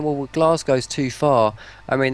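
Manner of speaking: ordinary talk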